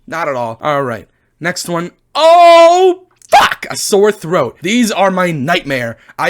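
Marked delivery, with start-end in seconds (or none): 2.1-3.7 high, loud drawn-out oh, then curse; theatrical agonised howl; pained outburst. Acted. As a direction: wail in dread, then swear. Reacts to sore throat coming up.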